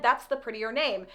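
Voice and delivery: ordinary delivery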